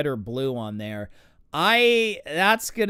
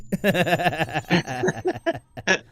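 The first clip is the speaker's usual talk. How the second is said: laugh. Real, not acted. Laughing after punchline.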